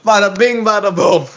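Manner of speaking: high, cartoonish Brooklyn swagger; hammed-up Brooklyn accent. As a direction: punch it out in mock Brooklynese. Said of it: "my Brooklyn accent".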